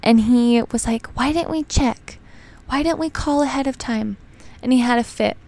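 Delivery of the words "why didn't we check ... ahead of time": high, small-voiced mock fretting; mock silly upset. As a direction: imitate a fussy complaint, higher and softer. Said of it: "just silly upset".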